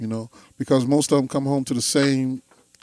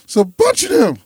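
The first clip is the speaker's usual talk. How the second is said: dismay; 10 semitones higher, 7 dB louder than usual. Retold or imitated. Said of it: "I was like".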